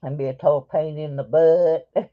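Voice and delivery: ordinary talk, nothing marked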